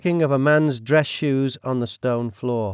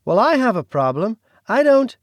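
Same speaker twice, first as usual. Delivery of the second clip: rising irritation, higher pitch; perturbed; 11 semitones higher, 6 dB louder than usual. Acted. Josie objecting to strangers staying, perturbed. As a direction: push back, getting more upset. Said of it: "Josie started becoming perturbed".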